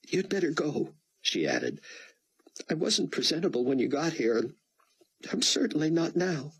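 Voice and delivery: put-on a tearful young woman owning up; Chloe. A narrator's reading. Chloe confesses to Buck, high and tearful.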